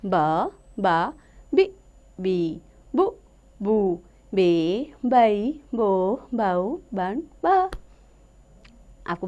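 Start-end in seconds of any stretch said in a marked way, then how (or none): 0.0-7.7 slow, drawn-out teacher's chant of each syllable; slow syllable recitation. Shown as a demonstration. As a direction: sound out every syllable slowly for children. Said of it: "अब हम पढ़ेंगे ब की बारह खड़ी". (धीमे-धीमे अक्षरों का उच्चारण)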